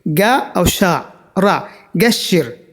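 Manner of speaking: slow syllable-by-syllable demonstration; slow letter by letter. Shown as a demonstration. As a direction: sound out each letter slowly, then the word. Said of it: "गा से बोलना है".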